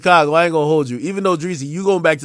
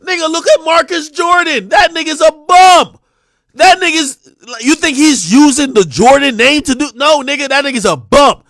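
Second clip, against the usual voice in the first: higher and louder, hammering the point; loud rant; 5 semitones higher, 5 dB louder than usual. Real, not acted. Streamer ranting at chat about Marcus Jordan, loud and heated. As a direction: shout him down as a bum.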